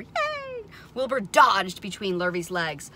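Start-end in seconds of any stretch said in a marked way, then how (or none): 0.0-0.6 high little cheer; goose cheering. A narrator's reading. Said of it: "The goose cheered for Wilbur".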